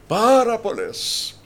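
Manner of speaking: high, loud and slow Πάρα πολλές; booming, drawn-out repeat; emphatic shout. Shown as a demonstration.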